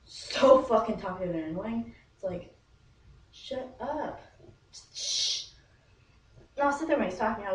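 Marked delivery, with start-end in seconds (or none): 3.3-5.5 curt shut up trailing into a hush; exasperated shushing. Retold or imitated. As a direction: tell them to shut up, then shush. Mimes hushing talkative Johnny.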